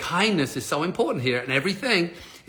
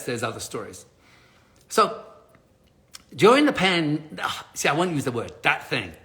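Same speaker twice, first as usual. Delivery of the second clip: ordinary talk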